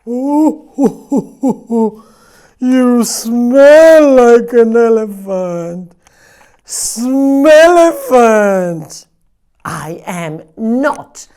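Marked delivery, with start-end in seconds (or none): 0.1-2.0 high, loud mooing meow; silly cow-like meow. Acted. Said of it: "I meow like a cow". 2.6-9.1 loud, high, slow teasing voice; taunting animal. Acted.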